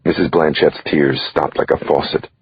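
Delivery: plain reading voice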